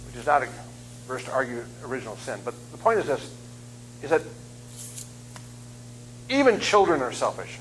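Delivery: normal talking voice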